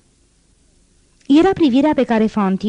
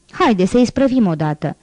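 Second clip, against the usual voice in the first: usual speaking voice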